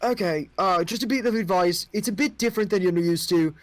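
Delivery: a curious king asking outsiders; young king. Acted. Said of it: "like, goes".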